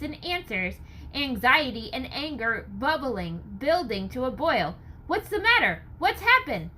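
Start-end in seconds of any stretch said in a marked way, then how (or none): 5.1-6.7 high, loud anxious questions; shouted question. Acted. Townsman shouts questions in the crowd, high loud.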